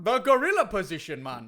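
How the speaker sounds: lilting put-on Jamaican wrestler; hammed-up Jamaican accent; Kofi Kingston, Jamaican accent. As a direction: protest in a mock Jamaican accent. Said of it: "you sound like you're from someplace else".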